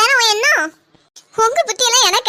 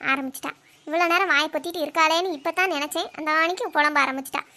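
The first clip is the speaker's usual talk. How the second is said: much lower, softer grumble under breath; muttered aside; 5 semitones lower, 6 dB quieter than usual. Acted. Daughter-in-law grumbling aside about mother-in-law, muttered. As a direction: grumble so she can't hear.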